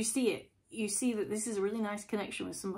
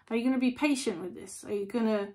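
usual speaking voice

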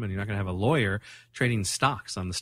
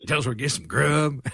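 hammed-up bad Western accent; fake cowboy accent. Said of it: "it's such a bad Western accent".

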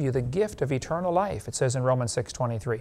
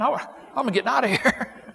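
laughing escape; 9 semitones higher than usual. Acted. Joke punchline.

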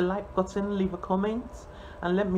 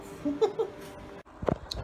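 little laugh. Real, not acted. Laughing at her own "oomph.

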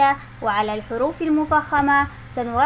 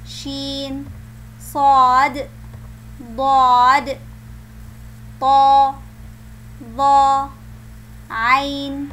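alphabet recitation; much slower than usual. Shown as a demonstration. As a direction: call out each letter for the children. Recites letters again for comparison.

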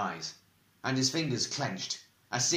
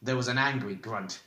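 ordinary delivery, nothing marked

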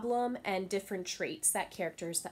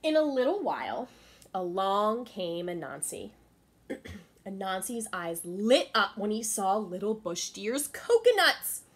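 lively, raised storytelling voice for the class; story narration; 5 semitones higher, 6 dB louder than usual. A narrator's reading. Teacher reading a story to her class, animated. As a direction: read it to the kids with big expression.

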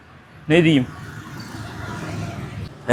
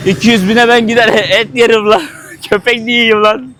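laughing through the words, higher and louder; laughing joke; 6 semitones higher, 5 dB louder than usual. Real, not acted. Vlogger jokes to viewers, laughing loudly. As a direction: crack up while joking. Joking about the price.

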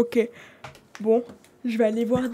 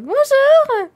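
very high, loud squeaky hello; high-pitched voice; 14 semitones higher, 8 dB louder than usual. Shown as a demonstration. Mother checking what the caller sounded like, mock high-pitched voice. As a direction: demonstrate a loud, exaggerated high voice. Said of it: "Une voix aiguë en mode".

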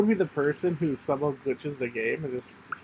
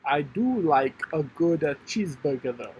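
lightly put-on Italian Russian accent, slowed; silly accent. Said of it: "That's a great accent".

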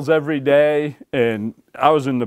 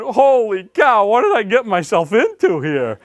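alarmed; 8 semitones higher than usual. Retold or imitated. Retelling panic in truck.

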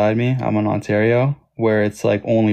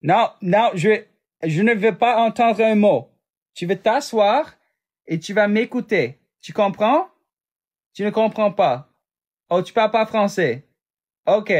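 much higher-pitched mock telling-off; French sass; 10 semitones higher than usual. Streamer ordering someone to sit and listen, sassy scolding. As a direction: lay down the law in high, sassy French. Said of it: "Can you give us sass in French?".

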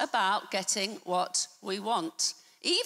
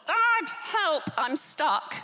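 put-on urgent child's call; 6 semitones higher, slower than usual. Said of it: "there's a banging on the door".

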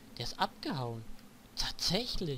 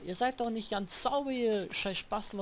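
ordinary talk

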